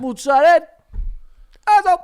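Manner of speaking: high, shouted dialect food words; dad's Italian-American. Retold or imitated. Imitating his dad's food words, loud Italian-American. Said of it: "he said all that".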